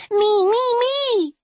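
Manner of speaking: put-on very high, loud child's squeal; eager little girl. A narrator's reading. Little girl volunteering for orange juice, eager squeal. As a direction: squeal to be picked. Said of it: "Cupcake cried".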